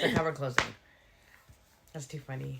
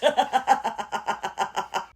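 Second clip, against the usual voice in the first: high, helpless belly laugh; long real laughing fit; laughter; 4 semitones higher, 4 dB louder than usual. Real, not acted. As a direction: crack up completely. Cracking up at impression.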